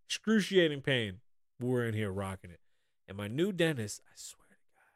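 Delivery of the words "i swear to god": barely audible whisper; whispered oath. Real, not acted. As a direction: swear it under your breath. Muttered oath mid-complaint, unvoiced.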